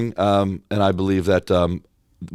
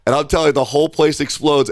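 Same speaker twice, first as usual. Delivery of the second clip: ordinary talk, nothing marked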